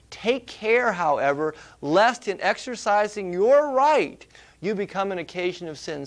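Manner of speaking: quoting scripture with emphasis; scripture reading. Acted. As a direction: give the verse weight. Quotes Paul aloud.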